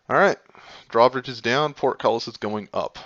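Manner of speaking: loud announcing scene setting; game master narrating. Acted. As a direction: boom it out like a herald. GM sets scene.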